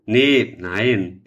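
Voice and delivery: usual speaking voice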